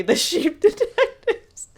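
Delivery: high, slow, loud punchline breaking into laughter; can't hold the laugh on the title. Real, not acted. Telling a friend the joke's punchline, laughing hard.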